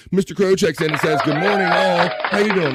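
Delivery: loud, high welcome shout; hyped announcement. Real, not acted. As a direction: call out the viewer's arrival with gusto. Hypes a viewer checking in.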